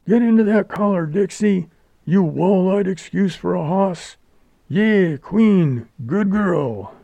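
high-pitched driver urging on his horses; shouting driver. A narrator's reading. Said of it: "shouted the driver".

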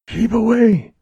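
high-pitched cry of alarm; hoarse cry. A narrator's reading. Jerry backs from the looming bandit, crying out in alarm. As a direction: warn him off, suddenly frightened. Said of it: "she cried hoarsely".

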